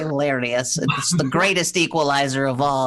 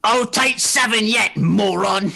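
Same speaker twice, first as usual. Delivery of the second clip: angry yelling back; 5 semitones higher, slower than usual. Acted. Floyd shouting back at his mother, angry. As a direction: shout back, sleepy and furious.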